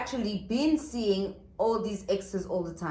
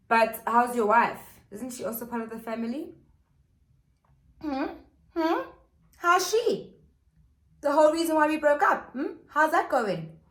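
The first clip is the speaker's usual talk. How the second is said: pointed needling; 6 semitones higher, 3 dB louder than usual. Retold or imitated. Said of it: "she's like".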